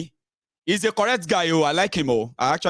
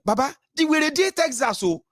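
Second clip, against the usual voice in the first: mocking retort; 9 semitones higher than usual.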